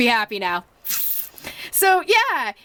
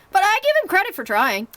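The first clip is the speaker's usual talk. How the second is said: normal talking voice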